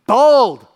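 "bold" punched loud; "bold" said with a loud, forceful push; stress on bold. Shown as a demonstration. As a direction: hit "bold" hard and loud. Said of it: "telling us to be".

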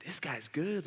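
very soft, awed whoa; impressed crowd. Acted. Crowd impressed by the lawyer's answer, hushed awe. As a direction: murmur it in hushed admiration. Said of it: "Crowd's going".

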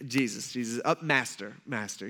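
low, halting, deferential address; trying to be respectful. Acted. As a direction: ease in politely, voice dropping. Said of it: "he's trying to be respectful".

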